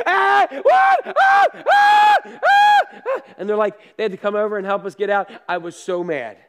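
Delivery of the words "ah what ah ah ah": high, loud terrified shriek; imitated child's scream, much higher and louder. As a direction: shriek like a frightened kid on a dark ride. Kids screaming on the ride.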